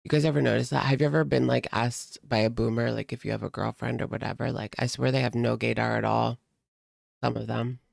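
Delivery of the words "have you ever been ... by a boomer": normal talking voice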